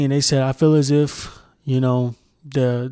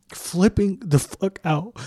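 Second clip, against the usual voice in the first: "Flipping the fuck out" stretched slow and higher; drawn-out emphasis; 5 semitones higher, slower than usual. Real, not acted. Mocking the reaction to kneeling, "Flipping the fuck out" drawn out. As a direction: drag out each word of "Flipping the fuck out". Repeats phrase slowly for emphasis.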